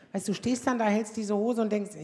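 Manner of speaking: normal talking voice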